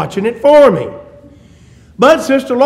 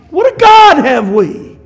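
ringing exclamation; 7 semitones higher, 6 dB louder, slower than usual. Real, not acted.

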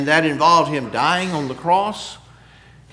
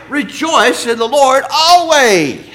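higher, louder and slow, drawn out; proclaiming the verse; 6 semitones higher, 6 dB louder, slower than usual. Acted. Preacher proclaiming the verse to the church, slow and loud. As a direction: stretch every word like a call to praise.

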